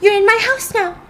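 very high, loud, unhinged threat; crazed shout. Retold or imitated. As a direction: shriek it as a menacing warning. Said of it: "her eyes are all crazy".

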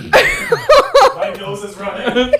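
slow, high laughing words. Real, not acted. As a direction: squeal it out through laughter. Laughing through hot sauce pain.

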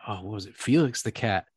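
usual speaking voice

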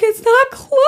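very high alarmed wail; panicked cry. Retold or imitated. Retelling the garage door failing, a very high panicked cry. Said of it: "I was like".

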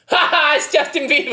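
mock jeering laugh. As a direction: act out pointing and laughing at a star. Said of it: "I wouldn't be like".

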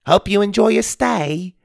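put-on high-pitched voice with British accent; British-accented boy. A narrator's reading. Boy greets Jake across the room, high British accent. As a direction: call a polite welcome in a British accent. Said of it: "also speaking with an accent".

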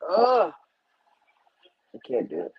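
annoyed ugh. Real, not acted. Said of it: "I just don't like it".

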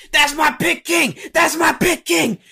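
high, loud mock shouting; Booker T. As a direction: yell it like an overexcited wrestling commentator. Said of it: "He's like".

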